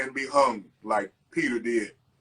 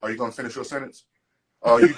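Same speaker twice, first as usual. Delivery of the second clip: hammed-up impatient asker, rapid and loud; impatient listener; 8 dB louder, faster than usual. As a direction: imitate someone fed up waiting for the end. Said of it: "motherfuckers in the room talking like".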